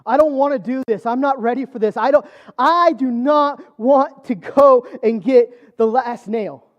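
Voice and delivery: loud, high, desperate refusal; building to slow, forceful, word-by-word protest; freaking out. Retold or imitated. Dreamer pleading with the Lord, loudly freaking out. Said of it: "I'm starting to freak out".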